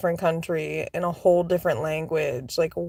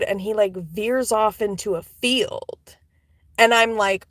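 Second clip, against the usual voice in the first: normal talking voice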